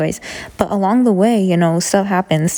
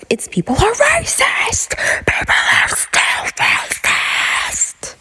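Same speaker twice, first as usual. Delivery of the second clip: hushed scream, voice held back; whisper yelling; slower than usual. Real, not acted. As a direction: scream it in a whisper so nobody wakes. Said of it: "I have to whisper scream".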